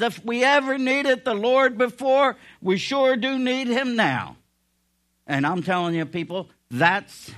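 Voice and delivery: full-force preaching. Acted.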